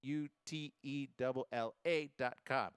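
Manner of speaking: letter by letter; careful letter-by-letter spelling; spelled out. Shown as a demonstration. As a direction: spell it out for listeners, one letter at a time. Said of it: "How do you spell it".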